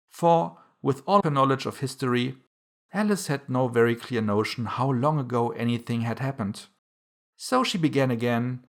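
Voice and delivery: usual speaking voice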